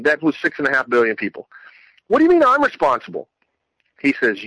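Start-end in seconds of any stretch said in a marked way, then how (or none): 2.1-3.3 high, stunned outburst; incredulous protest. Retold or imitated. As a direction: shoot the question up high in shock. Protesting to God in vision.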